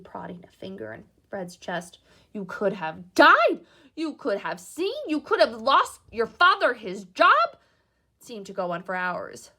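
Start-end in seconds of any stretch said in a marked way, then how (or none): 2.3-7.6 loud, slow, higher furious yell; furious shouting. Acted. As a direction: hammer each line at him in rage. Shouted herself hoarse.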